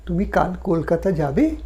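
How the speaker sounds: rising question with the lift on যাবে; rising question tune. Shown as a demonstration. Teacher showing a question by tune alone. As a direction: end যাবে on a clear upward lift. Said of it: "এভাবেও প্রশ্ন করা যায় মানুষকে".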